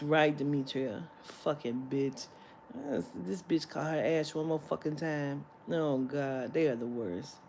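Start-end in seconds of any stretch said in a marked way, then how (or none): none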